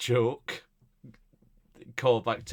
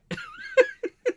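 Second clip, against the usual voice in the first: very high squealing laugh; high squeal of laughter; 8 dB louder than usual. Real, not acted. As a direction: squeal with laughter at your own joke. Laughing at own joke.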